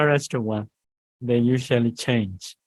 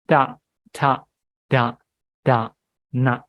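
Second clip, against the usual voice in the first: each syllable set apart with pauses; careful slow recitation. Shown as a demonstration. Teacher to students, reciting the dental row slowly and carefully. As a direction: recite the Pali consonants carefully, one by one. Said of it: "I have to recite carefully for that".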